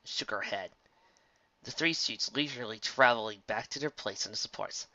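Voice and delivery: ordinary delivery, nothing marked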